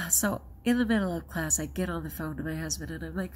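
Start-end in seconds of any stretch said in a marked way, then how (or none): none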